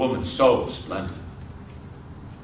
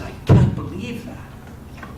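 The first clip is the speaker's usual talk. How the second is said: breathy, drawn-out disbelief; 7 semitones higher, slower than usual. Acted. Oisin told three hundred years have passed, acted disbelief. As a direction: stretch it out, voice half gone with disbelief.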